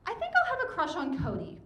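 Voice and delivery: high-pitched, put-on ninth grader; high school self. Reading her own teenage journal to the audience, high school self. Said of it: "high school me wrote".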